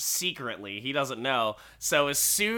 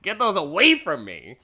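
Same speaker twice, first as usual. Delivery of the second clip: loud exasperated yelling; hammed-up yell to get away; mock yell; 3 semitones higher, 8 dB louder than usual. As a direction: snap at him to back off. Crane yelling at Sickles in retelling.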